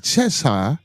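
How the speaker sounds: put-on English accent, rising end. Shown as a demonstration. Trying English place names for the chat, put-on English accent. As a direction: attempt Cheshire in an English accent. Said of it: "my English".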